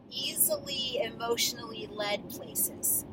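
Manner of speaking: ordinary delivery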